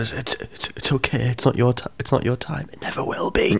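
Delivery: soft, half-whispered mock farewell; mock deathbed reassurance. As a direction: soothe a dying comrade, hammed-up. Acts a sperm comforting a dying one.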